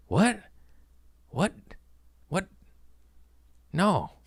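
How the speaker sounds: high-pitched, stunned little questions; acted incredulous reaction; baffled disbelief. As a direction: blurt it, pausing between each one. Reacts to pickled ears offer.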